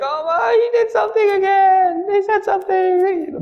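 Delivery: put-on high, crying whine of a picked-on kid; whiny victim kid. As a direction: wail it like the kid who always gets hit. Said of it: "they're always like".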